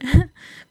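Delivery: quick burst of laughter; a quick self-mocking laugh; short laugh. Real, not acted. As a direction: laugh at yourself. Laughs at calling herself llorona.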